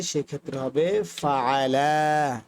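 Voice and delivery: ফাআলা with the second আ stretched long, slow; slow, drawn ফাআলা for the dual; long vowel pull. Shown as a demonstration. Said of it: "একটু টান দিতে হবে".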